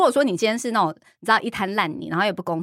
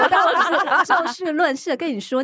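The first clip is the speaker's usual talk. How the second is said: higher pitched, laughing through the words; 9 semitones higher, 3 dB louder than usual. Real, not acted. Laughing as she insists to her friend. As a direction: giggle while insisting.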